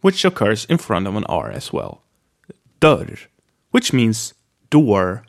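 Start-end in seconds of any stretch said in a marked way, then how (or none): 2.8-3.3 Dörr with a short open ö and rolled r. Shown as a demonstration. Teacher modeling Dörr, short open vowel. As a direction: clip the ö in Dörr. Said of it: "the short open".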